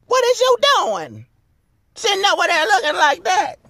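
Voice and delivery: very high falsetto scolding; shrieky high-pitched mock complaint; playful fussing. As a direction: squeal the questions in mock outrage. Playful fuss over lipstick.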